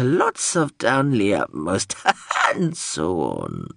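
slow, strange, singsong machine babble; a confused robot drone rambling; babbling drone voice. Acted.

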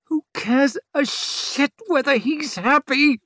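high, strained furious hiss; hissed through gritted teeth. A narrator's reading. Labouring mother snapping at the surgeon, furious hiss. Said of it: "hissed Savine through her gritted teeth".

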